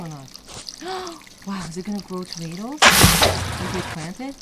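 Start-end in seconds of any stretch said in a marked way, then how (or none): 0.3-1.4 high, soft gasp; quick intake of breath in wonder; little gasp of surprise; amazed gasp. Real, not acted. Reacts to the growing plant.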